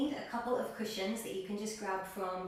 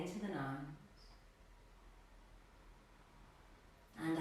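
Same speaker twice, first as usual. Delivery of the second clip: calm yoga teacher cueing breaths, long pauses; guided meditation; 3 semitones lower, 8 dB quieter than usual. Real, not acted. Yoga teacher settling class into a seated position, guided meditation. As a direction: settle them gently into stillness, phrase by phrase. Leads extended exhale practice.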